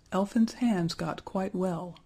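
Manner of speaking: ordinary delivery, nothing marked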